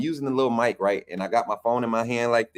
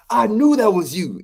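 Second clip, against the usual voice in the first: coach's voice pitched much higher, lightly imitated; Jamarcus Shepherd voice; 10 semitones higher, 5 dB louder than usual. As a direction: quote the coach in a raised, put-on voice. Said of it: "this my Jamarcus Shepherd voice".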